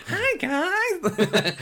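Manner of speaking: high, loud, laughing impression of a star's greeting. Shop worker imitating a star greeting the staff, high mimicry. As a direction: mimic a bubbly, squeaky celebrity hello. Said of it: "[name] was hi".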